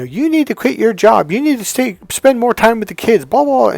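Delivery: high-pitched mock nagging wife; mimicked nagging. Husband mimics wife demanding he quit his job, nagging. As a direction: imitate a wife's repetitive complaining.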